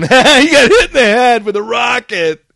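high, loud laughing holler; laughing soldiers. Retold or imitated. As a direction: crow it through mock laughter. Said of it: "everybody starts laughing".

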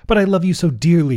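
persistent. A narrator's reading. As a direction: press the point as a rejected suitor. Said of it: "he persisted".

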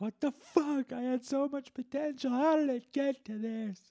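very high, strained whine of a washed-up self; future self lament. Retold or imitated. Future self watching old podcast, whining high. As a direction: whine like a future loser mourning lost luxuries. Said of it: "I'll be like".

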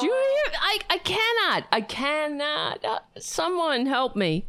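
high-pitched, can't-take-it pleading; exasperated disbelief. Real, not acted. Host reacting to protesters singing, exasperated and high. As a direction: plead for rescue, high and dismayed.

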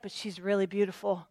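understated insinuating enemy voice; sly tempter. Acted. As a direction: tempt them quietly. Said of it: "the enemy's like".

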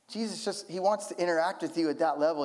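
ordinary talk

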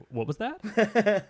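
slow, high, puzzled "what was that"; mock bewildered guy; puzzled bystander. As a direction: drag it out like a baffled onlooker. Guy reacting to a sneeze.

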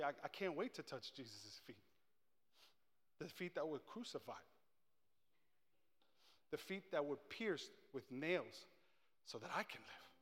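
soft, intimate appeal to the congregation; hushed preaching. Real, not acted. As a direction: bring the sermon down very quiet.

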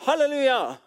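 high, loud hallelujah cheer; jubilant praise. Acted. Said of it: "juble og lovprise Gud".

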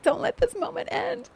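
very high-pitched, emotional retold plea; squeaky, overcome little plea, retold; tearful plea. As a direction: plead through tears and laughter. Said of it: "wiping the tears away, and I'm like".

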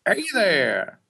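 lightly hammed-up friend greeting; Phil Lopez impression. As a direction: lift the greeting up into his voice. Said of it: "This is what he sounds like".

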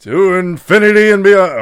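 loud, high heroic catchphrase, breaking into laughter; hammed-up space hero cry; Tim Allen. Friend warming up an impression, loud hero call. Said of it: "he gave me Tim Allen".

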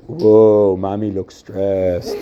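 low, drawn-out "whoa", mock observation; wrong tone of voice. Shown as a demonstration. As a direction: tease it slowly and tactlessly. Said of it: "imagine the kid says".

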